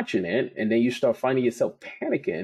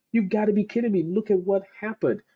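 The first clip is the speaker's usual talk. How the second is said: amazed disbelief; 11 semitones higher than usual. Retold or imitated. Retelling his amazed reaction.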